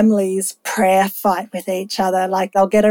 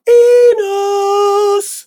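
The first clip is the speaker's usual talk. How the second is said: high, long-drawn booming call; voice from heaven; 10 semitones higher, 3 dB louder than usual. Acted. Heavenly voice calling the name.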